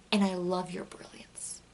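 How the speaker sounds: soft playful whisper. Real, not acted. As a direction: breathe it like a secret. Playful whispered aside to fans.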